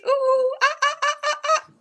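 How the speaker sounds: high hooting ooh ah; monkey calls. Acted. Monkey jumping into the trees, high hooting calls. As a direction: hoot like a monkey.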